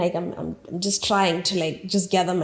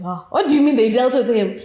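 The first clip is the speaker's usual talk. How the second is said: louder, puzzled and surprised; surprised question; 3 semitones higher, 6 dB louder than usual. Retold or imitated. Hearing security dealt with him, surprised and louder. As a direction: ask it startled and confused.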